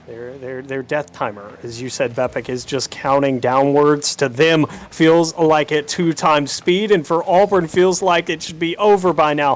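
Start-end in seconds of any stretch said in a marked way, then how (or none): none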